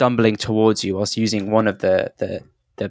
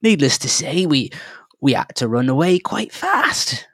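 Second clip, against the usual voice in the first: a fretful halfling, pitched up; halfling, higher; 6 semitones higher than usual. Acted. Halfling telling the travellers how they fled, higher and jittery. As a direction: tell it like a jittery little prospector.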